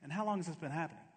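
mock clinical inquiry, rattled off lightly; unruffled doctor. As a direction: imitate a doctor asking over his glasses. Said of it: "I think that's a medical question".

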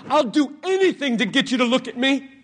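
fast, loud, urgent pleading; lightly voiced earnest Jesus, fast and loud; voicing Jesus. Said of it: "he comes to you and he says".

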